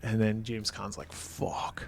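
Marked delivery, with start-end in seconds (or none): 1.1-1.9 voiceless, hushed expletive; retold gasp of dismay, barely audible; whispered curse. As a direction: let the swear escape on breath. Retold reaction to the shooting.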